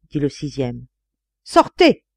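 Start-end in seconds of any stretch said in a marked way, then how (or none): 1.4-2.0 high, loud dismissal; angry order. A narrator's reading. Seventh friend throwing the narrator out, angry high command. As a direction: order him out with force.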